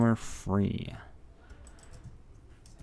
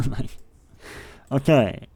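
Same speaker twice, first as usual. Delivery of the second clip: laughing okays, louder and higher; 4 semitones higher, 9 dB louder, slower than usual. Real, not acted. Streamer wiping the track, laughing louder. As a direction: giggle through a fresh start.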